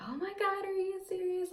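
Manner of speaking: squealing with surprise and joy; excited. Retold or imitated. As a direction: imitate sister's delighted disbelief. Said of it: "she was so excited".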